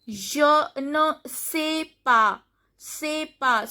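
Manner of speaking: "sais pas" said as "say pa"; "pas" ending on an open a; silent final S. Shown as a demonstration. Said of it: "S is not pronounced".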